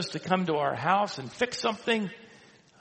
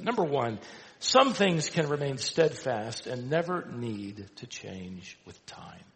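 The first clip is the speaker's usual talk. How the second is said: ordinary talk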